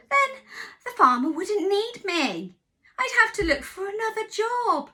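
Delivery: a rooster panicking over his job; worried rooster. Acted. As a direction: rooster afraid of losing work. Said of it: "said the rooster".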